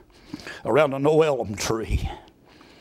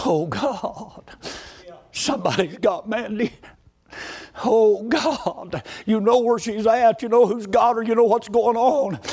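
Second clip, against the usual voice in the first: desperate retold prayer; 7 semitones higher, slower than usual. Brother praying for kidnapped niece, desperate plea. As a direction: plead with God in urgent need. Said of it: "It wasn't our most kind, gracious".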